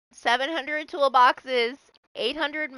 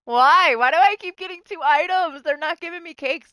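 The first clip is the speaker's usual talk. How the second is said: whiny outcry; 8 semitones higher, 7 dB louder than usual. Real, not acted. Complaining about bad blood web loot.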